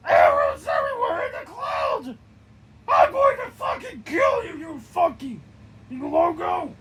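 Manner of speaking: loud, high, crying shouts; screaming. Acted. Cookie Monster screams at arrows raining down, panicked yelling. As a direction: shriek in panic under an arrow storm. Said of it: "he screams".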